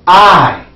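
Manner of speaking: loud clear model word; model pronoun. Shown as a demonstration. Teacher modeling a word for students to repeat. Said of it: "Let me hear you".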